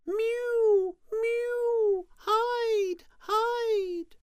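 very high, loud, drawn-out bird cries; crying buzzards. A narrator's reading. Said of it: "The buzzards cried".

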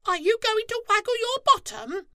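very high, loud, fast squeaky squirrel voice; cheeky squirrel calls out; Squirrel calling. A narrator's reading. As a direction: chatter it like a squirrel. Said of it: "flicked his tail and called".

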